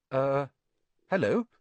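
a touch of nerves, hesitant; hesitant, uneasy greeting; nervous. A narrator's reading. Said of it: "said Harry nervously".

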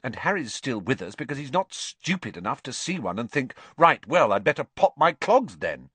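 high, emphatic know-it-all; superior. A narrator's reading. Girl explaining Grims to friend, superior tone. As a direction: lecture with smug certainty. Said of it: "in a superior tone".

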